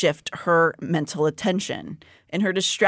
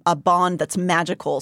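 usual speaking voice

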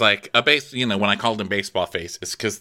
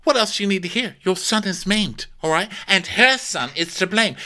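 much higher indignant mom voice; mother defending her son, pitched much higher; 10 semitones higher than usual. Retold or imitated. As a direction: demand it like an offended mother. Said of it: "Alicent's like".

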